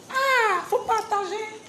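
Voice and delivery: crié très aigu et fort; cri excité. Real, not acted. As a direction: lance l'appel en criant, tout excitée. Appel aux spectateurs, cri aigu. (appel crié aux spectateurs pour partager, très aigu)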